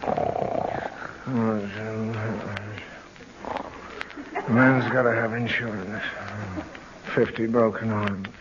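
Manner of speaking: low mumble from deep sleep; sleep mumbling. Acted. Daddy muttering in his sleep about insurance, drowsy mumble. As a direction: talk like you're dreaming, barely awake.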